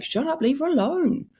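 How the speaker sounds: high-pitched mock heckle; imitated audience shout. Audience member defending the guitarist from the manager, mock shouting. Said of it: "people in the audience are shouting".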